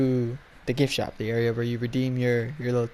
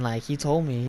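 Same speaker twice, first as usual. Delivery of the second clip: normal talking voice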